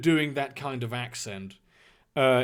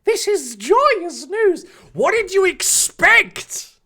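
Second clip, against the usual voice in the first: high, loud, slow hammed-up delight; overjoyed put-on boy voice; Harry Potter; 10 dB louder, much slower than usual. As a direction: proclaim it joyously, stretching the words. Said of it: "Harry exclaimed delightedly".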